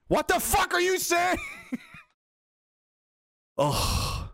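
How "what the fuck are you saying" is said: exasperated shout. Real, not acted. Yelling at scam phone call.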